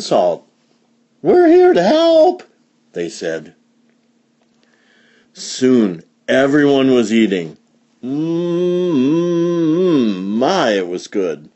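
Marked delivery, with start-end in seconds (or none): 1.2-2.4 high, loud, cheery announcement; crowd's high holler; calling out. Acted. 8.0-10.2 long, high "mmm" of relish; drawn-out yummy hum; savouring hum. A narrator's reading. Savouring the potato.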